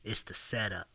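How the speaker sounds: breathy sexy whisper. Shown as a demonstration. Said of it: "in a real sexy voice".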